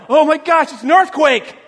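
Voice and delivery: a touch of alarmed excitement; lightly hammed-up earthquake alarm; mock panic. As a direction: blurt it out like the floor just moved.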